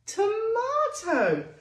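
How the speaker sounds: "tomato" high, slow and loud; drawn-out reveal. Shown as a demonstration. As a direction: stretch out "tomato" for the kids. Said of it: "beginning with the sound t".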